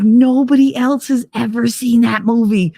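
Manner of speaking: high, eager retelling of a thrilled man; excited friend. Retold or imitated. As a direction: gush like you finally found a fellow fan. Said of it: "He was like".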